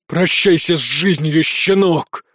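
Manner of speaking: slow, drawn-out villain growl of triumph; menacing threat. A narrator's reading. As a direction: savour the threat, slowly. Enemy's battle threat to hero.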